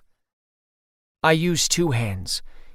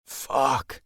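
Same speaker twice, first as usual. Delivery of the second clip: deep groan. Acted. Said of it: "in a deep groan".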